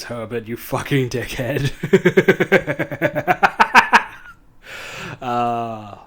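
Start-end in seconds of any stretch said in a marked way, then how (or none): 1.8-4.3 high laugh, rising, louder; real delighted laugh. Real, not acted. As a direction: let a laugh break out. Laughing at the villain insult.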